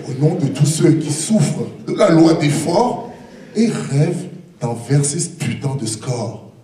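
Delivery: usual speaking voice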